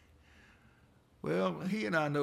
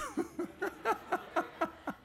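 airy high laugh at the joke; amused wheezing laugh; high breathy laugh. Real, not acted. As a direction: let out a high unvoiced laugh. Laughs at own punchline.

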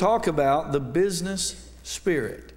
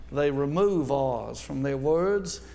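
a light, mock-posh South Alabama accent, R's dropped, said slowly; genteel South Alabama accent; slower than usual. Preacher mocking South Alabama folks in a lightly genteel Southern accent. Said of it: "They sort of talk like this".

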